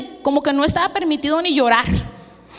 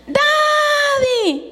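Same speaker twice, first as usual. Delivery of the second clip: put-on child's excited shout; 13 semitones higher than usual. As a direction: call out to daddy like a thrilled child. Child greets father at door.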